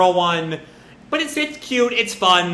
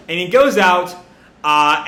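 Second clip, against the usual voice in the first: ordinary talk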